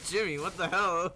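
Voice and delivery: loud, high what the hell at chat; startled laughing outcry. Real, not acted. Streamer shocked by chat messages, laughing outcry.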